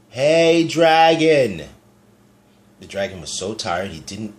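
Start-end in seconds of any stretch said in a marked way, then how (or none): 0.1-1.7 much louder and higher yell; loud shout. A narrator's reading. Elizabeth yelling into the sleeping dragon's ear, loud shout. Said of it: "She shouted as loud as she could".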